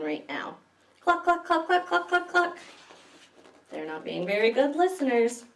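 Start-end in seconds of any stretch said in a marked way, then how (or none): 1.0-2.6 high hen clucks. Acted. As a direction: cluck like excited chickens. Chickens clucking loudly.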